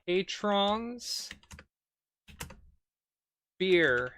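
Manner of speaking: slow, word-by-word dictation with gaps; halting, spaced-out typing voice; dictating while typing. Real, not acted. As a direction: read out each word as you type it. Types commands aloud.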